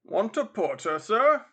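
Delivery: rising porter's call; porter touting. Acted. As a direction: offer service, jumping high and loud at the end. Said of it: "inquired a dozen men in smock frocks".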